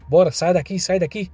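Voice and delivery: mild ordering out. A narrator's reading. Thugs clearing everyone from the funeral, mildly urgent orders.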